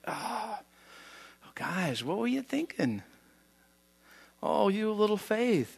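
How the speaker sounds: soft, drowsy gentle reproach; softly waking Jesus. Retold or imitated. Jesus just woken in boat.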